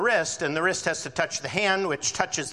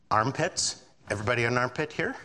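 normal talking voice

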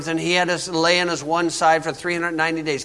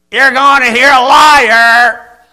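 slow, loud, higher shout; street preacher shout; 6 semitones higher, 11 dB louder, slower than usual. Pretend. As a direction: bellow it at the crowd outside the hall. Preacher yelling at bingo crowd.

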